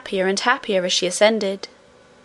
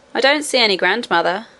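ordinary delivery, nothing marked

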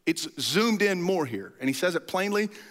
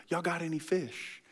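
much softer, offhand ask; casual Jesus; 8 dB quieter than usual. Acted. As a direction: toss it off quietly, deadpan. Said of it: "He goes".

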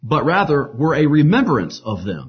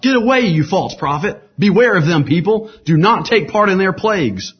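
high, louder warning cry to the crowd; calling out; 8 semitones higher, 6 dB louder than usual. A narrator's reading. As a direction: call out to the people, higher and louder. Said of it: "William called out".

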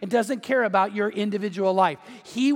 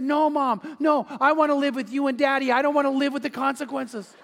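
higher-pitched imitation of a scared child; mock-panicked little girl begging mom; wide-eyed pleading; 4 semitones higher than usual. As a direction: plead like an alarmed five-year-old. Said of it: "she got wide-eyed and turned and said".

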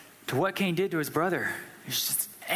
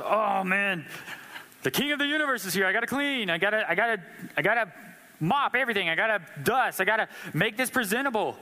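flustered Martha; 2 semitones higher than usual. Retold or imitated. Martha scrambling to clean for the king, frantic and rushed. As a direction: rattle off the chores in a panic.

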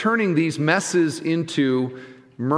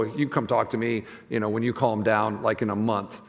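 softer, lower, mock sweet brush-off; passive aggressive; 7 semitones lower, 6 dB quieter than usual. Husband brushing off his wife in a conflict, mock passive-aggressive and softer. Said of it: "I can get passive aggressive and go".

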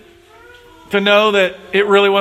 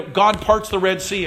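usual speaking voice

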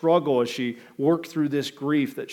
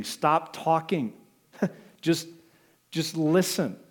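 slow down on stop talking; slow stress; 3 semitones higher, slower than usual. Shown as a demonstration. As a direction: stop talking said slowly, each word weighted. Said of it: "I would encourage you".

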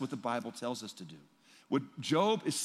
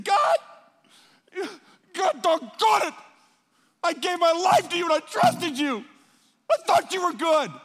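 huge, high shout at God; anguished mourner shouting; 11 dB louder than usual. Retold or imitated. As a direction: scream your betrayal to heaven. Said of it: "somebody just mourning and saying".

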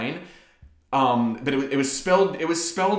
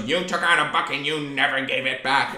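lightly voiced cartoon-villain librarian. Librarian accusing a pupil, mildly villainous. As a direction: imitate a villain making an accusation. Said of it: "like a cartoon villain's voice".

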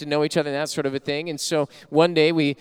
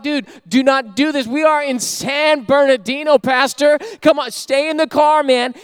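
urgent plea; 8 semitones higher, 6 dB louder than usual. Retold or imitated. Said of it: "I'm just like".

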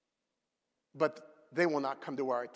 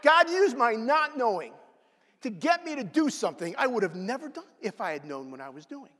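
louder and higher replay of the line; emphatic replay; 7 semitones higher, 4 dB louder than usual. Shown as a demonstration. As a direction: say it again with more force. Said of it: "Now I'm gonna rewind that tape".

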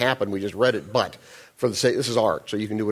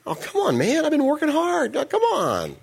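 much higher, stretched sulky whine; whining. Pretend. Older brother griping about working hard, mock whine. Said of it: "he's just whining".

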